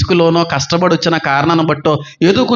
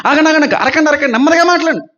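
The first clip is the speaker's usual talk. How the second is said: urgent plea to stop; 7 semitones higher, faster than usual. Retold or imitated. Voicing himself stopping a quarrel.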